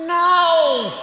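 sharp high shout; loud exasperated no. Acted. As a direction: bark out a loud no.

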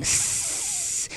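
high soft hiss; snake hiss. Acted.

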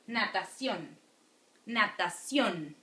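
"Natación" with a strong final "ción"; last syllable stressed. Shown as a demonstration. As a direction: land firmly on CIÓN in "Natación". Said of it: "the strong one falls in the last syllable".

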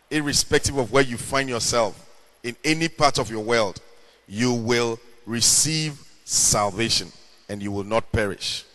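usual speaking voice

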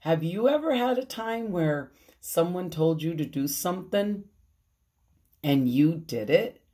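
ordinary delivery

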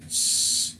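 long unvoiced "sh", no vowel; SH sound. Shown as a demonstration. Teacher demonstrates the letters S H, sustained sh. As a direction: hold a hushing sh. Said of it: "S H es como sh".

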